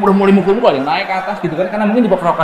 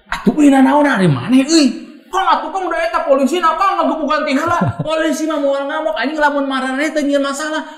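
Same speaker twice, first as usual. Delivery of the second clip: calling out to the crowd; 9 semitones higher than usual. Retold or imitated. Calming the rioting stand in Sundanese.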